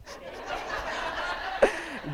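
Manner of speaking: airy giggle; breathy laugh. Real, not acted. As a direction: let out a soft laugh. Laugh after candle story.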